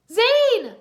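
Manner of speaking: high, loud cry of relief; joyful cry. Acted. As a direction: shout his name in delight. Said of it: "Lloyd cried".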